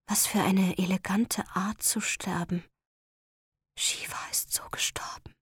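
soft breathy inner whisper. Acted. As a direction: breathe it like a last thought. Inner thought while being strangled.

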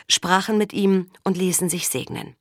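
usual speaking voice

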